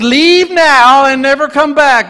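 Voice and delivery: high, loud, slow command; banishing command. Acted. Smeagol banishing Gollum, high, loud and slow. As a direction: cast him out with a slow, ringing order.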